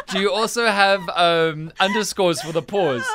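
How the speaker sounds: ordinary talk, nothing marked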